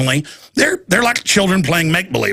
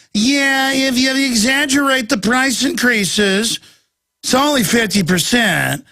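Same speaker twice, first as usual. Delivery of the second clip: high, drawn-out mock official voice; put-on bureaucrat voice, high and slow; 8 semitones higher, slower than usual. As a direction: pitch it up and stretch out the words. Mimicking Australian officials' warnings.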